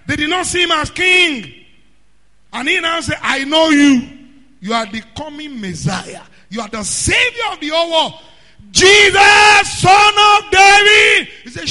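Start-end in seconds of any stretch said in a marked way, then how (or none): 3.2-11.3 builds to a higher, louder shout; crying out. Retold or imitated. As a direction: climb into the cry for help. Blind man crying out.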